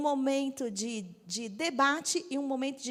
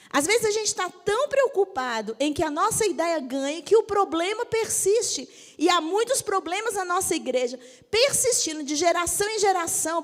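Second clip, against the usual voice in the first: fervent preaching; 5 semitones higher, 6 dB louder than usual. Real, not acted. Preaching at full force.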